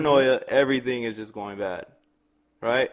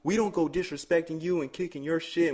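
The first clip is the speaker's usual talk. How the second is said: higher, heated telling-off; mock angry rant; 6 semitones higher than usual. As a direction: scold the neighbor hard. Girlfriend angrily telling off neighbor.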